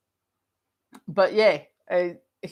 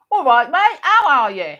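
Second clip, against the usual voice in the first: loud, high-pitched put-on London accent; exaggerated London geezer greeting, imitated; 8 semitones higher, 9 dB louder than usual. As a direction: belt it out as a proper Londoner. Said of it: "he could speak proper London".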